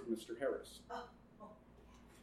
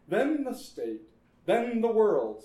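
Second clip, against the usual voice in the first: higher and louder each step; dramatic build; 7 semitones higher, 5 dB louder than usual. A narrator's reading. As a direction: climb to the world. Dramatic escalation.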